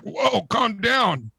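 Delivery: slow it right down to talk someone off a ledge; alarmed placating. Acted. Bartender to pianist smashing his glass, alarmed placating.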